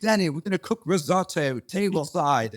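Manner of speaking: a playful British-accented Gordon Ramsay impression; a host imitating a celebrity chef in a British accent. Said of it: "I can't do a Gordon impression very well".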